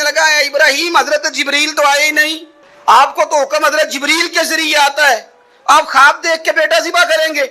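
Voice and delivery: a hint of a sly tempter; devil tempting Ibrahim. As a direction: voice the devil luring him off course. Preacher voicing the devil's whisper.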